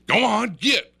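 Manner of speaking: loud, very high holler; acted-out sharp yell to scram; imitated friend shooing bear. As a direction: shout it away like a dog. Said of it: "Jim says".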